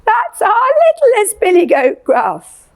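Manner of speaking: small quavery goat voice up high; high little goat voice. Shown as a demonstration. Teacher voicing the littlest billy goat. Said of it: "quite a quiet voice and quite a high, quavery".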